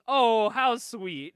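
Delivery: loud, drawn-out, slow gushing; mock-sweet villain entrance. New villain arriving to kill Clownface, mock-sweet greeting. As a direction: stretch it out with theatrical relish.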